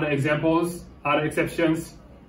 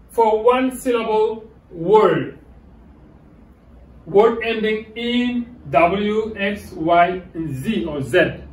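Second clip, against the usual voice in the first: slow dictation; 3 semitones higher, slower than usual. Shown as a demonstration. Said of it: "teacher reading rule slowly".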